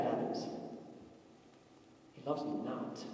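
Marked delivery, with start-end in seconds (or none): 2.1-3.0 much softer, lower, hopeless line; a believer after bad news; dejected. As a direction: let it fall flat in despair. When something bad happens.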